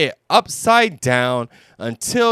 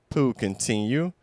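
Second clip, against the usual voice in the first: ordinary delivery, nothing marked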